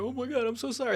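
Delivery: mock apology. Mocking an on-air apology.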